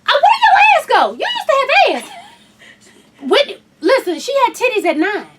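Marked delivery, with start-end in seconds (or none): 0.0-2.1 high, loud laughing tease; loud playful mock outrage; teasing shout. Real, not acted. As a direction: yell it at your sister for fun. Teasing sister loudly.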